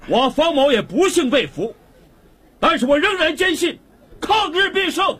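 very high, impassioned public speech; rousing oration. Acted. Captured commander declaring his faith to the crowd, rousing. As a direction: build defiantly towards the slogan.

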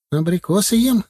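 put-on high boyish call down, putting on bravery; brave shout. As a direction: call it down cheekily, voice kept steady. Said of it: "крикнул он вниз, стараясь держать голос молодцом". (бодрый мальчишеский выкрик)

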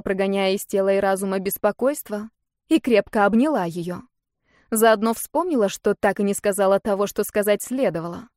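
usual speaking voice

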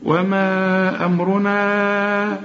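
chanted وما أمرنا with very long held vowels; four-count elongation. Shown as a demonstration. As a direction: stretch both long a sounds in وما أمرنا, very slowly. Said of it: "لازم تمد أربع حركات".

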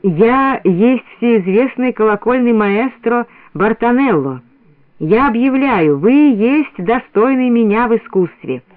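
slow, grand foreign maestro; stately, drawn-out self-introduction; solemn proclamation. A narrator's reading. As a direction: proclaim it slowly, with great ceremony. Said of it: "произнёс торжественно".

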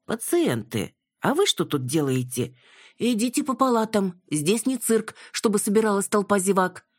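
scolding nurse. A narrator's reading. Nurse sends curious patients back to wards, scolding.

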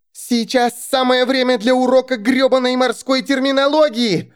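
high, furious scream; shouting. Acted. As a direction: scream it in frustration. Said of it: "закричала Алекс".

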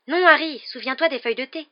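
high, urgent plea; alarmed warning. A narrator's reading. Two girls warning Harry off, high and alarmed. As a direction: warn a friend in alarm. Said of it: "murmurèrent d'une même voix".

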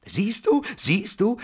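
high, loud, gleeful exclamation rising at the end; delighted shrill-pitched gloating; beaming satisfied cry. A narrator's reading. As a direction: crow it triumphantly, beaming. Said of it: "rief sie strahlend zufrieden".

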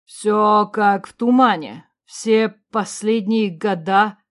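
plain reading voice

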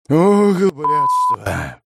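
a drawn-out hoarse wheeze; hoarse groan. A narrator's reading. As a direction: rasp it out, slowly, in pain. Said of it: "прохрипел я".

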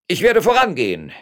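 high, loud, self-important mayor; puffed-up official; conceited declaration. A narrator's reading. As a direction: announce it pompously at full voice. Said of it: "erklärte der eingebildete Bürgermeister".

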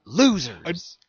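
high, loud imitation of an angry yell; retold loud yell. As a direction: belt it out, mimicking his outburst. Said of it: "he called us losers really fucking loud".

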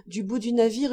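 ordinary delivery, nothing marked

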